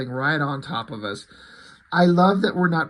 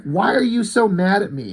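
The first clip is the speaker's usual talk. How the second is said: higher, louder acted complaint; exasperated demand; 6 semitones higher, 3 dB louder than usual. He asks why she is so mad, exasperated. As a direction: demand an answer in a mock quarrel.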